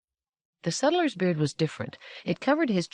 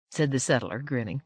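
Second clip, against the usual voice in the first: plain reading voice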